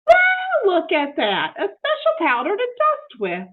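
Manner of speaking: very high, loud delighted Well; excited exclaim. Acted. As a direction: burst out thrilled at the find. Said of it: "exclaimed Amelia Bedelia".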